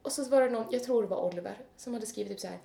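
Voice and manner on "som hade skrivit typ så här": ordinary talk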